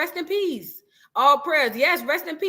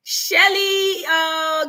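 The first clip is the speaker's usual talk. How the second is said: excited call-out; 5 semitones higher, 4 dB louder, much slower than usual. Real, not acted.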